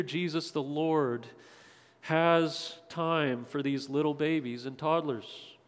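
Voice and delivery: ordinary talk, nothing marked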